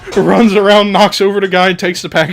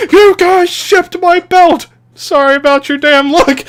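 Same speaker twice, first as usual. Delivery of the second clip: mock outraged yell; 14 semitones higher, 6 dB louder than usual. AJ in a retold ad, hammed-up yell. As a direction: scream it like a wronged wrestler. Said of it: "And then A J goes".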